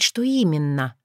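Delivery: plain reading voice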